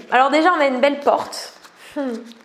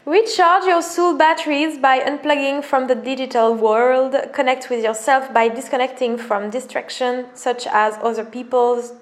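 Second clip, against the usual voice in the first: French-accented English. Shown as a demonstration. French vlogger reads the English card in her accent. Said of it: "Sorry pour mon accent".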